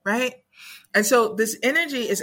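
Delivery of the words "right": higher, coaxing grandmotherly voice; wise ancestors. Retold or imitated. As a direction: soothe them round the kitchen table. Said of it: "the wise ancestors is like".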